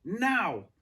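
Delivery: loud, high now; shout. A narrator's reading. Director shouts at David, loud. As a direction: yell it right at him. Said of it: "shouted Davenport in his face".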